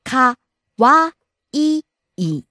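かわいい broken into か and わいい, pitch rising on わ; syllable by syllable. Shown as a demonstration. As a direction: split かわいい slowly, rise on わ. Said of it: "Rising on the second syllable".

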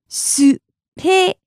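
"Spain" as Japanese "su-pe-i-n", beats apart; syllable by syllable. Shown as a demonstration. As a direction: slowly separate each sound of "Spain", Japanese-style. Teacher breaks word into syllables.

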